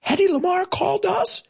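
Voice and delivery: high, squeaky giddy disbelief; Mel Brooks cracking up. Retold or imitated. Flattered director hears a star wants to sue, imitated giddy delight. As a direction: imitate someone tickled pink, voice way up high. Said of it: "and he's cracking up".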